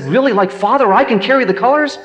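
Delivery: high-pitched excited boy, acted out; thrilled disbelief. Boy hoping to be like father, thrilled. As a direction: rise into an awestruck, hopeful question.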